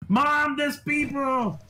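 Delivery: put-on high, slow kid call into the house; kid shouting for mom. GM as the kid calling his mother about visitors, high and drawn out. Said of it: "you can hear him shouting".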